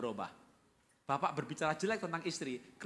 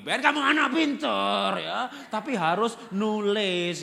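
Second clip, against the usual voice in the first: loud, higher, slow scolding of Ben; angry praise; 7 semitones higher, 11 dB louder, slower than usual. Acted. Parent shouting at Ben to do his writing. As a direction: drag out the words like an exasperated parent. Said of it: "kita marah lagi".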